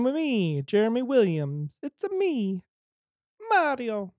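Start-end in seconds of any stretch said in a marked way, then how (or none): 1.8-4.1 high, Italian Mario voice; It's a me, bouncy Italian. Pretend. Mario impression.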